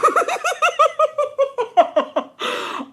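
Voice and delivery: high, prolonged cackle; real laughing fit. Real, not acted. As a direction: burst into a long squealing laugh. Laughs after outburst.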